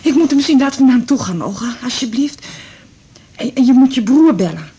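urgent, frantic, pressing her daughter; panicked pleading. Acted. New widow frantically begging her daughter to go to him.

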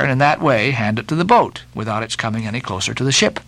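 ordinary delivery

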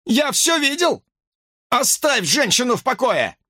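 police order; commanding shout. A narrator's reading. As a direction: demand it firmly. Cop ordering suspect.

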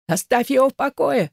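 high-pitched desperate cry; shouting. A narrator's reading. Trapped man defends Elaza, high narrated shout. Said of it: "закричал он".

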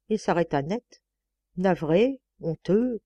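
ordinary delivery, nothing marked

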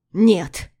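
one sudden loud cry; shouted no. Acted. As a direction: throw out a desperate shout. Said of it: "выкрикнул он".

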